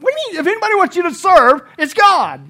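high, emphatic disbelief; loud, high-pitched rebuttal; incredulous. Real, not acted. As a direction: fire back in exasperation, pitch way up. Preacher rebuts the doubters.

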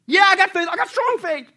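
loud, high, frantic assurance; desperate yelling. Acted. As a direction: insist in acted panic. Desperate tourist answers the voice.

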